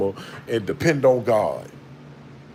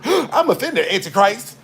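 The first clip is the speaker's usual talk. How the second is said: much higher squeaky mock indignation; mock offended; 7 semitones higher than usual. Mocking offended people at the end times, squeaky mock outrage. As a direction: play a prissy person huffily telling off the Antichrist.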